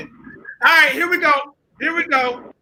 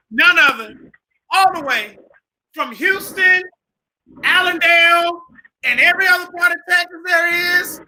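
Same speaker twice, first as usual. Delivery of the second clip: announcer introduction; 5 semitones higher than usual. Acted. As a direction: sell the hometown like a ring announcer. Host introducing second guest.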